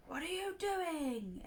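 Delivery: a hammed-up angry god; bellowing war god. Fake Ares shouts at rising Clarice, slow mock roar. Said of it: "Ares bellowed".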